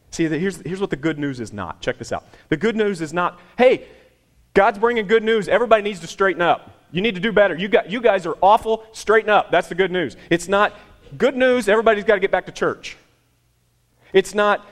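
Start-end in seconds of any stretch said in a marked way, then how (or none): 3.6-9.5 loud, high mock scold of the crowd; sarcastic scolding preacher voice, raised and pushed. 11.2-13.0 mock preachy bark, high and loud; hammed-up church nag; scolding preacher.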